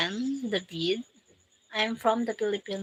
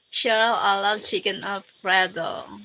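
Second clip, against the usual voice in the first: ordinary talk, nothing marked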